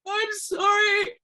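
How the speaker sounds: high, loud, drawn-out cry of sorry; a stung, pained wail; reliving the slap in a loud, high, slow sorry; hurt apology. Retold or imitated. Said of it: "that's why that".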